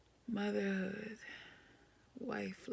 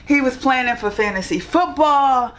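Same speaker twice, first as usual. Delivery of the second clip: much higher and louder, still giddy; loud excited gush; 6 semitones higher, 24 dB louder than usual. Real, not acted. Wife praising husband to camera, loud giddy gush. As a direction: gush about him, still buzzing from laughing.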